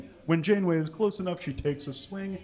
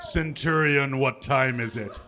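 villain lord voice; 5 dB louder than usual. Acted. Villain lord and centurion exchange.

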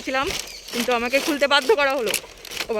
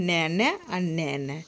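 imitating a child; 5 semitones lower, 4 dB quieter than usual. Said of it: "খালি বলে".

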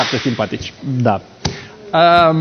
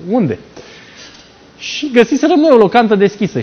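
ordinary talk

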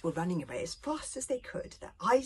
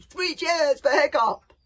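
loud, high, slow rallying cheer; rousing cheer; 10 semitones higher, 13 dB louder than usual. A narrator's reading. Thuggery rallying the boys for Hiccup, loud slow cheer. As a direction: lead the crowd in a big hurrah.

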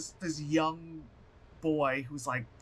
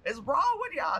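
incredulous shout; 8 semitones higher, 4 dB louder than usual. Retold or imitated. Own shocked reaction retold.

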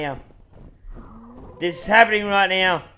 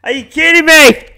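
yelled much higher and louder; furious shout; 10 semitones higher, 14 dB louder than usual. Real, not acted. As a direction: blow up at your teammate. Angry at teammate losing Peter.

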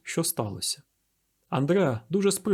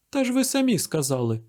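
high-pitched protesting exclamation; acted young woman's outburst, pitch raised; exclaiming; 8 semitones higher than usual. A narrator's reading. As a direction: remind him with some heat. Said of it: "вигукнула Ежені Дангляр".

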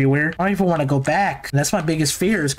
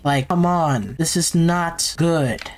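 usual speaking voice

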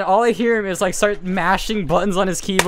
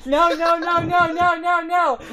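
imitated distant yelling; 10 semitones higher than usual. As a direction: mimic a friend yelling from another room. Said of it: "I can hear him yelling from his kitchen".

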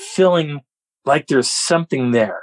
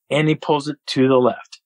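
ordinary talk, nothing marked

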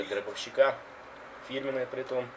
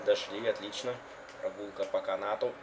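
ordinary delivery

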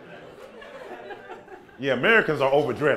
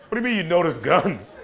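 normal talking voice

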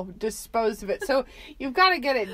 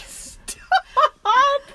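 laughing retold yell; 14 semitones higher, 7 dB louder than usual. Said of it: "And we're like".

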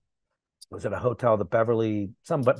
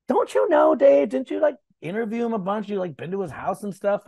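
higher, insistent friend voice; a lightly voiced teasing friend, pitched up; quoting Renee; 9 semitones higher than usual. Friend nudges him before the press conference, higher teasing.

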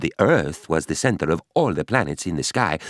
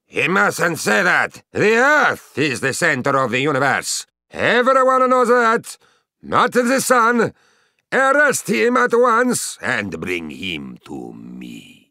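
furious Pope; 7 semitones higher, 3 dB louder than usual. Retold or imitated. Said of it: "he really blew his top".

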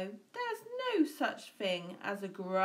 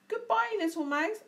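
high, loud hasty goodbye; snake farewell; 11 semitones higher, 6 dB louder than usual. A narrator's reading. Snake fleeing the Gruffalo, high hasty goodbye. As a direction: blurt it and flee. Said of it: "off he slid".

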